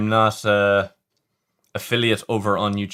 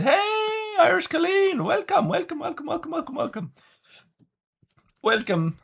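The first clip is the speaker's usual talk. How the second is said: high sing-song welcome. Pretend. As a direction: greet them like a chirpy cartoon host, trailing off. Welcoming viewer in silly voice.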